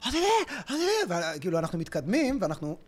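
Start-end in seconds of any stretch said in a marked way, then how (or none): 0.1-1.3 high-pitched but soft mock screeching; retold screaming. Friend imitates a couple's street row, soft mock screaming. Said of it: "אני רואה אותך שם צרחות".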